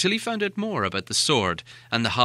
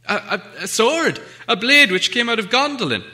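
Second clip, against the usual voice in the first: higher, louder halting hobbit; nervous stammer; 12 semitones higher, 8 dB louder than usual. Acted. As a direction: stammer while holding up the sword. Bilbo stammers with sword.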